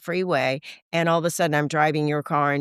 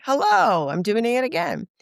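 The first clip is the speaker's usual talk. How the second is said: self-mocking hello; 7 semitones higher than usual.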